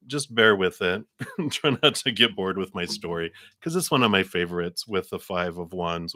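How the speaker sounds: normal talking voice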